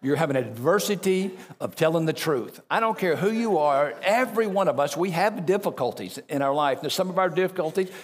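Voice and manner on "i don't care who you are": ordinary talk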